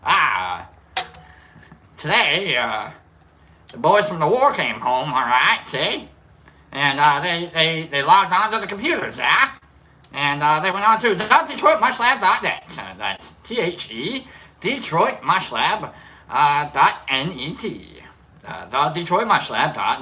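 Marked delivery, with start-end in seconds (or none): 0.0-12.8 put-on loud, high old-timey newsboy yelling headlines; New England newsboy voice. Shown as a demonstration. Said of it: "like, a New England newsboy voice". 13.4-18.0 slow spelled-out. Shown as a demonstration. Spelling out the URL slowly.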